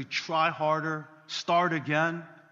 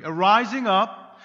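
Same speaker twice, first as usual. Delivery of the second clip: slow, louder rising proclamation; preaching emphasis; 6 semitones higher, 11 dB louder than usual. Real, not acted. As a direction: lift it up with force. Building sermon emphasis.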